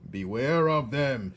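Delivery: loud, slow warning shout. Acted. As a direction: warn her loudly. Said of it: "shouted Gregory".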